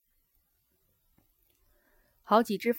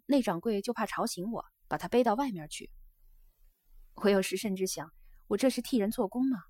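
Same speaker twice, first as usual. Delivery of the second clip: animated geisha with emphatic openings; confide, a touch aggrieved; geisha pouring out small grievances; 4 semitones higher, 6 dB louder than usual. Acted. Geisha tells her guest about the household she works for, earnest chatter.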